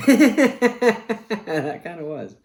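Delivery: high, loud laugh into the words. Real, not acted. Host amused by his sound effect, laughing. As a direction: crack up, then concede it.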